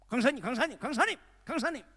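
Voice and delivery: high, loud calls after someone; running up behind the preacher, calling him loudly. Retold or imitated. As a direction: shout after the preacher as you run. Man runs after preacher calling.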